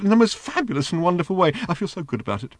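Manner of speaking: high, bubbling, eager robot voice; gushing little robot. A narrator's reading. The flying robot begging Ford to sit on his lap, high and gushing. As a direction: gush like a lovesick little machine.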